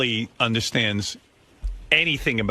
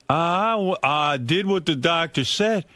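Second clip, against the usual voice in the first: slow, higher, mopey complaint; whiny Richard; 6 semitones higher, much slower than usual. Retold or imitated. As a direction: drag out the sulking, pitched up. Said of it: "He's like".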